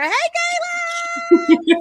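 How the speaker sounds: very high, long drawn-out hello; high cheering call to a friend; excited greeting. Real, not acted. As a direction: squeal the greeting and stretch it out. Host greets introduced guest.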